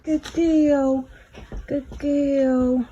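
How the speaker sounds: loud high praise for the cat; encouraging cat. Real, not acted. Owner encouraging her labouring cat with loud praise. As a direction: cheer her on as she pushes. Coaxing cat through birth.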